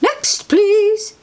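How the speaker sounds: high, sing-out call; storybook librarian's high shout. A narrator's reading. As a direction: call out high to the next in line. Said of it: "comes another shout".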